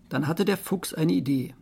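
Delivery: usual speaking voice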